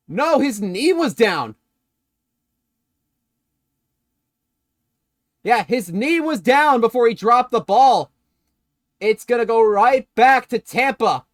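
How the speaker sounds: insistent live football commentary; excited play-by-play. Real, not acted. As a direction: declare the ruling with rising excitement. Calls a reversed play live.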